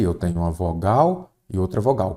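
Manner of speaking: ordinary talk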